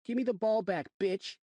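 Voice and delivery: put-on a mouthy boy sneering at a grown-up; bratty kid. Rude kid demanding ball.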